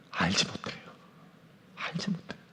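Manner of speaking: breathy whisper, barely there. Real, not acted. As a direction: let it fade to a whisper of despair. Repeated close on despair.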